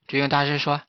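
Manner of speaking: usual speaking voice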